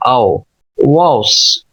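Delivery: आओ, वाओस drawn out with rounded aw; full rounded vowel. Shown as a demonstration. Teacher builds the syllable for learners, slow rounded vowel. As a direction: slowly round and fill the vowel. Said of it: "इस तरह से पुर करना है".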